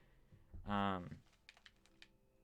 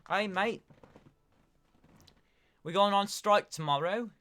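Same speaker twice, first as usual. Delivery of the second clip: loud, high-pitched mock British accent; 10 semitones higher, 8 dB louder, slower than usual. Streamer imitating striking teachers for Casey, loud put-on British accent. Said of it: "a British accent".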